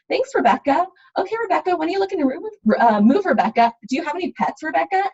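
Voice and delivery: high, sugary overfriendly agent; overly sweet phone voice. Shown as a demonstration. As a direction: overuse the name, too eager. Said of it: "it gets kind of awkward".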